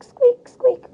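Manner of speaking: high, loud squeaky wheel noise. Acted. Dog's cart wheels rolling, high squeak.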